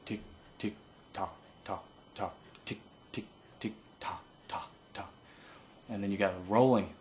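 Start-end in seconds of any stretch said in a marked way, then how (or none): 0.0-5.1 voiceless tongued feed call; whispered tick-tock. Shown as a demonstration. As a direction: quietly click out the rhythm. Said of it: "one that goes tick tick".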